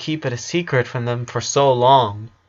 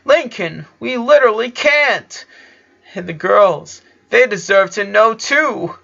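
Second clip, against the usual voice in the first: tearful protest; 9 semitones higher than usual. A narrator's reading. Luna crying, arguing with Lincoln.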